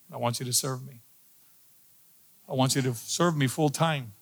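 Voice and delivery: soft, low, measured request; solemn divine calling. Retold or imitated. God collecting on a praying man's promise, hushed and solemn. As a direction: imitate God speaking quietly, with pauses. God calls him during prayer, voiced.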